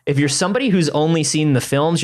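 ordinary delivery

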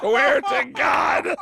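high, loud, drawn-out shout; emphatic insistence. Real, not acted. Comic swearing to his host the story is true, emphatic shout.

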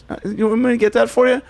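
high, put-on little kid voice; high-voiced imitation of a child; helpful kid. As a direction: pipe up high like an eager kid offering help. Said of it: "kid who, like, sees an old lady".

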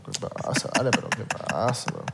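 hushed whispered aside. Real, not acted. Host whispering to co-host about the rude pour, hushed. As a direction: whisper it so the guest can't hear.

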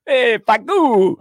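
high, loud, drawn-out holler; mock call-out. Co-host showing what the funny word sounds like, loud drawn-out shout. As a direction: holler the name like an exclamation. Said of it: "that's what it sounds like".

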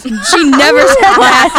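usual speaking voice

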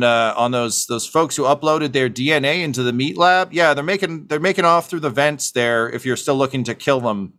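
fake friendly comms voice. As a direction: sell the lie to security over the radio. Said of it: "turns on the comms, um, and just says".

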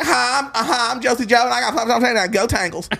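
mock a peppy college girl; college girl video. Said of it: "they're like".

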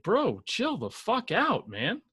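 laid-back bro voice, stretched out; imitating a chill bro telling someone off; frat bro. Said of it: "even Belichick's probably like".

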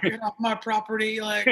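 gruff homesteader chasing people off; frontier settler. Retold or imitated. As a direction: bark it like a frontier man guarding land. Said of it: "like a frontier person who's like".

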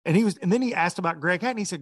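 ordinary talk, nothing marked

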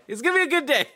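mock self-hype topping the first line; hammed-up excited. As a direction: push the pep talk way over the top. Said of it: "So I was like".

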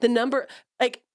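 usual speaking voice

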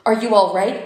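loud, alarmed question; sudden fear for her son. Acted. Mother hears police station.

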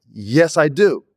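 high, mock-outraged protest; indignant retort. To the director after the dinghy stunt, indignant and high. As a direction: push it up into disbelieving outrage.

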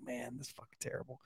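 breathy whisper under his breath; whispered aside. Retold or imitated. Dad whispering his embarrassment while his wife explains. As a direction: whisper it to yourself, mortified. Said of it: "I was like".

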